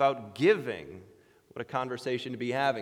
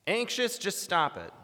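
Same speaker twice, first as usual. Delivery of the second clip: higher, lightly mocking command; dismissive mock advice; 7 semitones higher than usual. Believer bluntly discipling an anxious friend, mock dismissive. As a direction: brush the worry off with an order.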